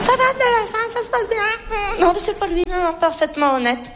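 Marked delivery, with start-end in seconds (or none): 0.0-1.4 high, chirpy check-in; cheery calling out. Acted. Manager hailing Abdel mid-accusation, falsely cheery. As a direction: hail a colleague in a high voice. Breaks off to hail colleague Abdel.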